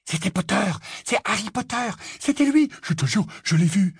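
high, hushed-to-eager chatter; excited pupils. Acted. Pupils spotting Harry in passing, excited. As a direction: pipe up as if spotting someone famous.

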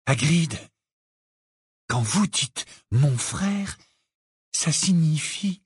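very slow, careful question. A narrator's reading. Harry asks Hagrid what brother means, slowly. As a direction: space the words out cautiously. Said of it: "demanda Harry d'une voix lente".

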